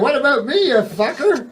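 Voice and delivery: high-pitched mock outrage; exasperated comic protest; indignant retort. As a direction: fire back at a whiny ghost, voice way up. Acted retort to the whiny ghost.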